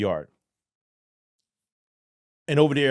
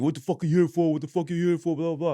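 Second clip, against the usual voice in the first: inmates asking; 7 semitones higher than usual. Retold or imitated. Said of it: "they're talking".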